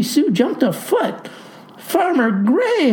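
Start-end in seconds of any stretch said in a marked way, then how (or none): 1.8-2.9 high, drawn-out mock holler. A narrator's reading. Clerk startled by the farmer's return, hollering. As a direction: call out high in alarm, without real volume. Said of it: "hollers she".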